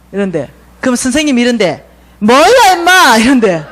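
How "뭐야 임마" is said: put-on high, loud drunk snapping back; gruff mock yell. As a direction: bark at him like a rudely woken drunk. Homeless man yells at husband.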